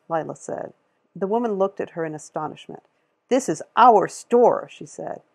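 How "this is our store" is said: loud, slow, insisting; indignant retort. A narrator's reading. Store lady snaps back at Lila, loud and indignant. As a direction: plant your feet and insist.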